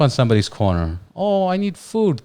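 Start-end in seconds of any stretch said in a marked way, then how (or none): 1.2-2.2 high, drawn-out plea for food; imitating a beggar, pitched way up; mock begging. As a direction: put on a high, slow beggar's whine. Mimics a begging homeless person.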